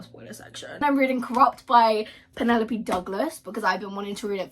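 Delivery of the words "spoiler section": breathy secret whisper. Real, not acted. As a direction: drop to a whisper for the spoiler. Hides a spoiler, voice drops out.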